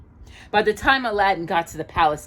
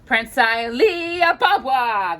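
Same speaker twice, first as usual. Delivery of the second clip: a slow, louder, higher greeting from a lightly voiced beaming old king; a hint of a jolly sultan, slow and raised; delighted sultan; 7 semitones higher, 6 dB louder, slower than usual. A narrator's reading. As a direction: welcome him grandly, drawing out the name. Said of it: "the Sultan said with a bright smile".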